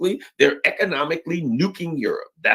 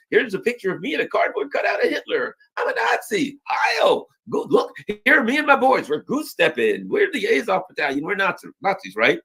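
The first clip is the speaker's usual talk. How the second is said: mock Nazi boaster; 7 semitones higher than usual. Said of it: "They got pictures".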